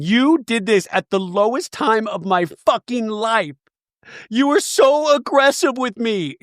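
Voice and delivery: high, drawn-out indignant imitation; mock accusation. Recapper imitating housewife blaming her friend, mock indignant. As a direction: lay on the wounded blame, slow and high.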